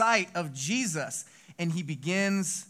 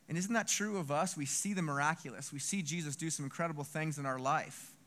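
ordinary delivery

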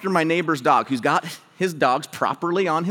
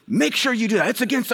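higher-pitched mock scolding; yelling neighbor; 6 semitones higher than usual. Neighbor going after the dog owner, retold yelling. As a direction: keep on at them like an irate neighbor. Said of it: "going after me and yelling at me".

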